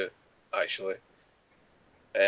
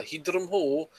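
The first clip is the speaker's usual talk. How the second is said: mock a bad Scottish accent, pitched up; higher, caricatured attempt at a Scottish accent; 5 semitones higher, slower than usual. Scot mocking actors' failed attempts, bad Scottish accent. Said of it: "always sounds kinda".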